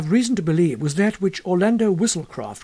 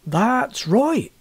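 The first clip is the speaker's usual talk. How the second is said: villager agreeing; 6 semitones higher than usual. Acted. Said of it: "said another".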